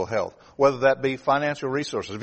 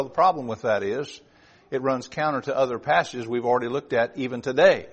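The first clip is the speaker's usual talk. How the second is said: ordinary delivery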